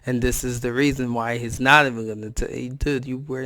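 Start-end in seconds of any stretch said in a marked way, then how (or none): none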